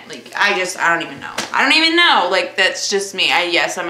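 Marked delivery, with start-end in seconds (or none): 1.5-2.3 the repeat pushed higher and louder; higher, louder exasperated second take. Shown as a demonstration. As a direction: throw up your hands on the repeat. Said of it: "repeated higher and louder".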